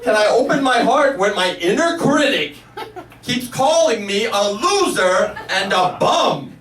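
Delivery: high, drawn-out host reading to a crowd; big theatrical reading, stretching the key words; Oprah. Retold or imitated. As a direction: read it out with relish, pitched high. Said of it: "she reads my question".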